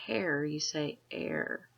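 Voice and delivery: heir without the H, slow; teaching heir with silent H; heir said like air. Shown as a demonstration. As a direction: say heir as air, not hair. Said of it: "You don't say".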